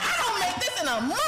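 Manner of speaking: fast, high-pitched laughing outburst; retold amazement. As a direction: re-enact giddy shock at the money. Retold reaction to big check.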